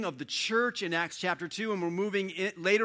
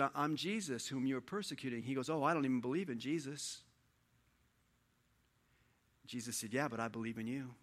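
softly voiced dialogue; 6 dB quieter than usual. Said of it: "The voice said".

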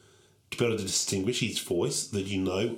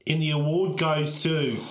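suspenseful awards presenter; 5 semitones higher, 4 dB louder than usual. Acted. Dramatic awards reveal.

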